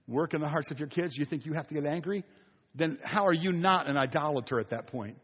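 normal talking voice

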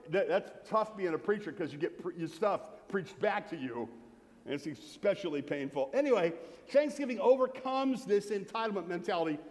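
ordinary delivery

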